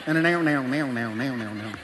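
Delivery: a hint of a Southern country accent; Southern banjo accent. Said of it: "he has this accent. You know, he talks in banjo".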